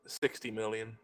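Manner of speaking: ordinary talk, nothing marked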